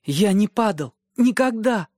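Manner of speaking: put-on high, insistent child's denial; insistent boy. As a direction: insist he never fell. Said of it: "настаивал Бран". (мальчик упрямо настаивает)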